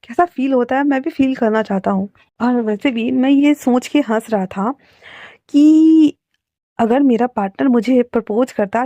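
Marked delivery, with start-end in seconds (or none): none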